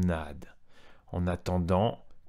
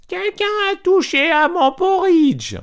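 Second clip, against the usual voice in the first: much higher, loud, slow mama bear cry; mama bear's voice; 11 dB louder than usual. A narrator's reading. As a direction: squeal it out as an outraged mama bear. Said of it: "s'écria d'une voix moyenne". (voix de maman ours, très aiguë et forte)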